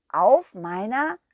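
ordinary delivery, nothing marked

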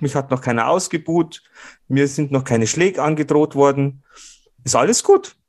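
high, put-on smug boss voice; imitating the boss. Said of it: "Dann schaut er mich so an und sagt".

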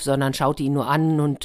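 usual speaking voice

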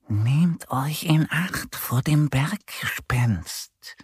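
slow, eerie whispered warning; whispering fox. A narrator's reading. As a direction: whisper it slowly as a secretive fox. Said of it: "Der Fuchs spitzt aus dem Busch heraus und flüstert". (langsam geflüsterte Warnung)

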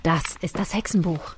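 hushed, secretive whisper; a witch whispering as she reveals the spellbook. Acted. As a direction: breathe it like a forbidden secret. Reveals the witch book by candlelight.

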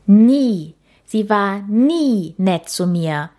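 slow, indignant huff, stress on Nie; snorting correction. A narrator's reading. Käthe correcting the ghost, snorting and indignant. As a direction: huff it out, offended. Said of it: "verbesserte Käthe schnaubend".

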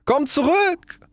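very high, drawn-out call. A narrator's reading. Rocket calling the departing duck back, high drawn-out cry. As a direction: call after someone in a high stretched voice. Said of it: "rief die Rakete".

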